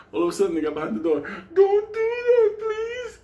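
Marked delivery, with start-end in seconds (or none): 1.5-3.2 high, crying, drawn-out begging; mock terrified plea. Teacher's friends behind the door begging in high, crying panic. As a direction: whimper it like a scared victim.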